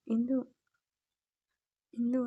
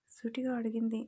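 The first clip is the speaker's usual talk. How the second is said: usual speaking voice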